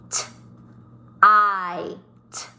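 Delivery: "T, I, t" as separate sounds with long gaps; crisp T, long "eye", crisp final t; sounded out. Shown as a demonstration. Teacher sounding out "tight" for pupils, T, I, t apart. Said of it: "I G H says I".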